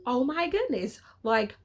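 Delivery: half-playful frustration at the character; mock yelling at TV. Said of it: "yelling at my T V".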